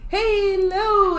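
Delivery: normal talking voice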